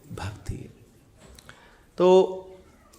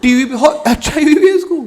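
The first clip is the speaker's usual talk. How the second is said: much higher and louder, laughing through mock alarm; mock shocked viewer; 7 semitones higher, 8 dB louder than usual. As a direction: gasp it out like a horrified aunty. Viewer shocked at host's illnesses. (ज़ोर से हँसते हुए नकली हैरानी)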